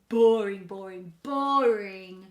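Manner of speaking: loud, drawn-out complaint; exaggerated boredom. A narrator's reading. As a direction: draw out each "boring" loudly. Bored Eloise complains about tutor.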